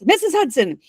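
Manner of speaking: high-pitched indignant call; theatrical, half-restrained shout; mock shout. A narrator's reading. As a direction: call out in outrage, pitched well up without full volume. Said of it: "then he looks up and he shouts".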